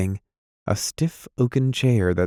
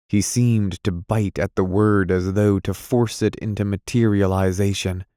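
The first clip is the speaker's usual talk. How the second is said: ordinary delivery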